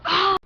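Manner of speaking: sudden loud real sneeze. Real, not acted. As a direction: let out a big sneeze. Sneezing repeatedly, says has to sneeze.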